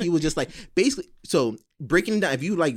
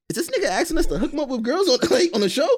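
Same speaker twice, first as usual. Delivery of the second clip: high, loud laughing question; laughing disbelief; 10 semitones higher, 3 dB louder than usual. Retold or imitated. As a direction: crack up in disbelief. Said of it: "and I'm like".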